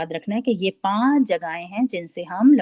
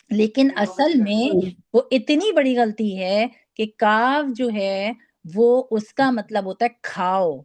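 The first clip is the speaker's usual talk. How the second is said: ordinary talk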